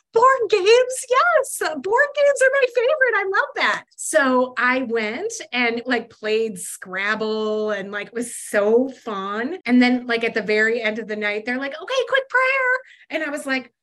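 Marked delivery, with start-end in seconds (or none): 0.1-3.9 very high, loud, gushing excitement; overjoyed. Retold or imitated. Lonely newcomer invited to play board games, overjoyed. Retold delighted reply to invitation. 11.8-12.8 high, loud, sing-out call; chirpy announcement. Retold or imitated. Church friends calling everyone to prayer at game night, chirpy.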